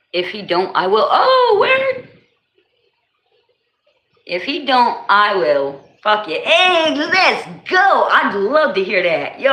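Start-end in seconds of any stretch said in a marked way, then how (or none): 1.1-2.1 much higher, louder excited yelp; thrilled oh word. Real, not acted. Excited reaction. 6.4-8.1 higher, louder hype cheer; excited let's go cheer; hyped. Real, not acted.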